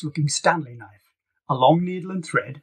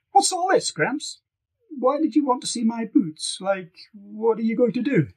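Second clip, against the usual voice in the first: put-on lifted, higher girl's voice; teen girl voice; 8 semitones higher than usual. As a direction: play the puzzled teenage girl. Bee voiced, much higher.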